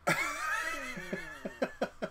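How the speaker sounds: very high, quiet laugh breaking into short bursts; real high-pitched giggling fit; high wheezy laugh. Real, not acted. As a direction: squeal with laughter, then trail into little pulses. Laughs during the stream chat.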